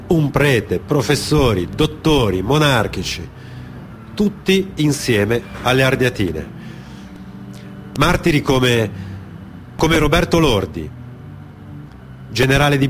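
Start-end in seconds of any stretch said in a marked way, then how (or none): none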